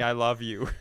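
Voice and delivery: normal talking voice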